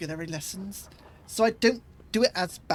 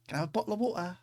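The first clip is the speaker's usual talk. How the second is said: "Can I have a bottle of water?" with glottal t's; British "bottle of water" with t's dropped; London accent; 2 semitones lower, 3 dB quieter, much faster than usual. Shown as a demonstration. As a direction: ask for "a bottle of water" in Cockney. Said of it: "make fun of my accent".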